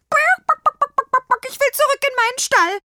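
very high, loud clucking whine; frantic chicken voice, piercing; overwrought demanding hen. A narrator's reading. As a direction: squawk that you've had enough. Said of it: "verlangte sie".

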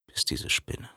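ordinary delivery, nothing marked

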